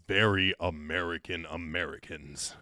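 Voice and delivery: "very American Americans" low and drawn out; "very American Americans" in a slow, low caricature; mock-emphatic AMERICAN.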